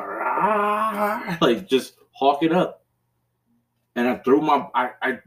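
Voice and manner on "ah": short high pained "Ah"; retold frustrated yell. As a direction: replay the cry from the clip, pitched up. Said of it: "there's a clip of me going".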